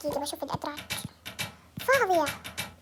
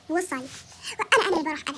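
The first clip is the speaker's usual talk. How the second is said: normal talking voice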